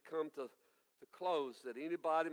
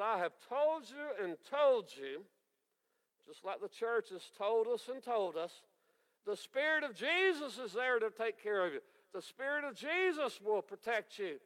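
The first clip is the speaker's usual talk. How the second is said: exasperated dad; 5 semitones higher than usual. Acted. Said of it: "a dad who's exasperated".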